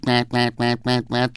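quick, even honks of a rubber-bulb horn; car horn imitation. A narrator's reading. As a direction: imitate an old motor horn bleating. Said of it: "ging der Gummiball". (nachgemachte Autohupe)

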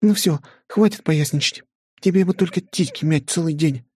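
put-on high, exasperated girl voice; scolding young woman. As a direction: tell him off, high and tired. Flora scolds husband.

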